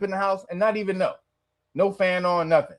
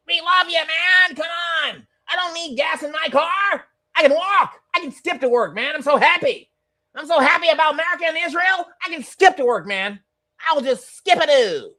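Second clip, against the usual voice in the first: high, loud sarcastic giddy cheer; mock glee; 8 semitones higher, 3 dB louder than usual. Streamer mocking a gas-free fan with hammed-up glee. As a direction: gush with fake happiness.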